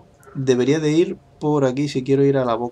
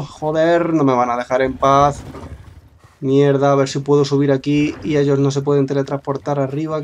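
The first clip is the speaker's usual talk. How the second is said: ordinary talk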